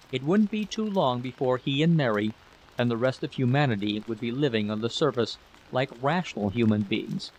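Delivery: usual speaking voice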